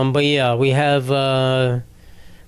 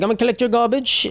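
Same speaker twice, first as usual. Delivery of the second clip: put-on higher British tour guide accent; mock British lady; 8 semitones higher than usual. Imitating the British tour lady on the bus, British accent. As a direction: play the British lady collecting garbage. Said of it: "like, British for some reason".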